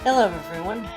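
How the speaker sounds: normal talking voice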